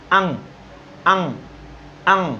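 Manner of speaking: "अंग" from the throat, ending in the nose, no full "ग"; nasal ending. Shown as a demonstration. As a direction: say "अंग" so the sound stops at the nose, no "ग" in the mouth. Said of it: "गले से निकला नाक पे ख़त्म". ("अंग" गले से निकालकर नाक पे ख़त्म, "ग" मुंह में नहीं)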